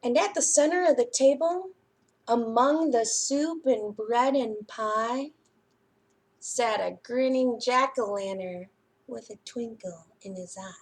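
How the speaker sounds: put-on lively storybook reading to children; picture book reading. A narrator's reading. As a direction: read it like a bedtime story for little ones.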